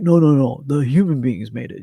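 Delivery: loud, put-on dismissive sceptic; mock debunker. Imitating a debunker explaining crop circles, loudly dismissive. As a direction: cut in loudly as a scoffing debunker. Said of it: "the skeptical person or the debunker".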